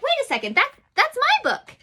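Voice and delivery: put-on a high little girl's surprised cry. Girl in the story claims the book, high surprised cry. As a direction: pipe up like a girl spotting her book. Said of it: "this little girl's like".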